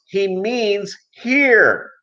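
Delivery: high, very slow explanation; lightly voiced lady. Lady in line explaining to the cashier, high and slow. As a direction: spell it out kindly. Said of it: "So lady in line goes".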